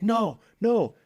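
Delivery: high, strained panicked no; panicky protest. Retold or imitated. Suspect panics at gunpoint.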